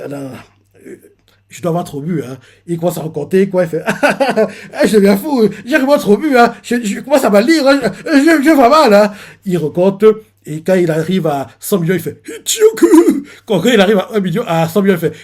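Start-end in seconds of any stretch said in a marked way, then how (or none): 3.8-9.1 put-on high, louder half-laughing drunk; a sailor who thinks he's drunk too much; rising giddy panic, laughing; drunk disbelief. Drunk man doubting his eyes. 12.3-13.2 high, slow stretched shocked cry; anime-style stunned shout; shocked yell. Retold or imitated. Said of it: "Il dit ça comme ça".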